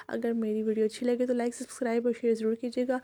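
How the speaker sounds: normal talking voice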